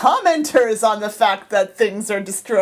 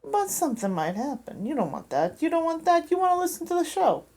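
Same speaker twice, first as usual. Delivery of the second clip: insinuate a threat in Jersey accent; 5 semitones higher than usual. Shown as a demonstration. Leaning on listeners to hear the show, Jersey accent. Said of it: "I can do Jersey".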